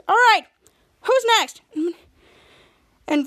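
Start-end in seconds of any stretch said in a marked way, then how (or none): 0.1-1.6 louder and higher, commanding; bold call. Retold or imitated. AWOL on the sleigh calls for the next one loudly. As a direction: call out to the group. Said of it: "A W O L says".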